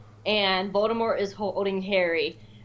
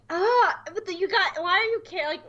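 much higher, incredulous protest; incredulous outburst; 7 semitones higher than usual. Retold or imitated. Watching the episode, fan baffled that Obi-Wan worries about younglings, incredulous high outburst. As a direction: exclaim in disbelief. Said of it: "I was just like".

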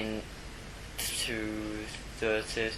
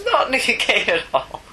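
high, slow, laughing sarcasm; cracking up mid-denial; sarcastic laugh; 11 semitones higher, slower than usual. Acted.

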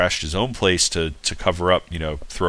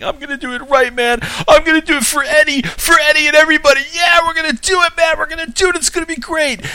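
much higher, louder, fast hammed-up pep-talk voice; cheesy Joe Pantoliano; 6 dB louder, faster than usual. Mocking the actor as he vows to finish it for Eddie, hammed-up and much higher. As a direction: ham up the excited vow like a cheesy actor. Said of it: "Joe Pantoliano just does this whole thing".

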